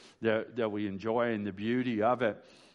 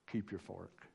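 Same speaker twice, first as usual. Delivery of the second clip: soft, confiding aside, much softer; leaning-over murmur; 4 semitones lower, 12 dB quieter than usual. Retold or imitated. Church friend leaning over at potluck, soft confiding murmur. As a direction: lean in and murmur it like a tablemate.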